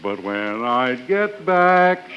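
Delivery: ordinary delivery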